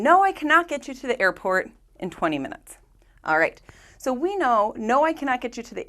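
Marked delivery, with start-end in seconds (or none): 0.0-2.8 a mild mock yell, a little higher and brighter; teacher hams up the driver's refusal; mock cab driver scream. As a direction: read it like an irritated cabbie shouting back. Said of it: "the cab driver scream".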